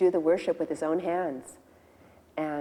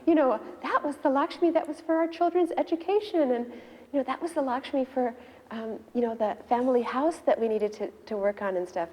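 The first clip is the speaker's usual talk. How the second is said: higher, pleading protest from the wife; higher-pitched dismayed complaint; upset wife; 6 semitones higher than usual. Retold or imitated. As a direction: remind him what that money was for. Wife upset about lost savings.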